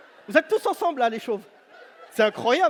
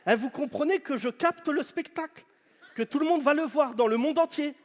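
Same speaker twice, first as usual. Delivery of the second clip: usual speaking voice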